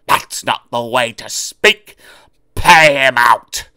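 sly trucks plotting to pay Oliver back; scheming trucks. Acted. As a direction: plot the revenge slowly.

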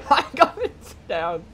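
giggling through the words; laughing. Real, not acted. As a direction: laugh while talking. Giggling while sabotaging fight.

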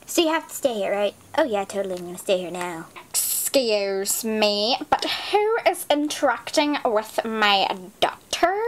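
usual speaking voice

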